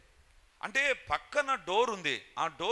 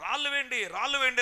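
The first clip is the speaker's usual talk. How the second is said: higher, louder retold panicked yell; driver yelling; 7 semitones higher, 4 dB louder than usual. Driver yelling at the youths to throw stones, higher and louder. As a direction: call out urgently for the stones. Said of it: "driver గట్టి గట్టిగా అరుస్తున్నాడు".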